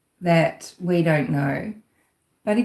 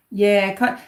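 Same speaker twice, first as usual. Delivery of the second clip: usual speaking voice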